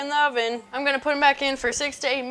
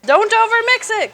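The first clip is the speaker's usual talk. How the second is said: much higher, louder warning; shouting; 11 semitones higher, 9 dB louder than usual. Real, not acted. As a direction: call out in alarm. Yelling at the mixer.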